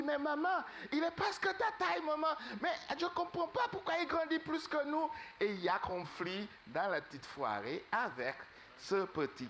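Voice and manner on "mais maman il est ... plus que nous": put-on high childish whine to mother; little ones tattling to mama, pitched up high; children complaining. Ducklings telling mother the odd one grows too big, high childish voice.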